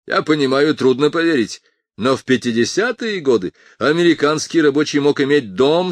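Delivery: passionate speechmaker voice; a politician addressing a crowd; Morton's speech. A narrator's reading.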